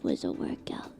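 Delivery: breathy voiceless aside; whispered aside. Real, not acted. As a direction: whisper "my job was a workout". Aside to chat.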